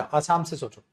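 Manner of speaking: ordinary talk, nothing marked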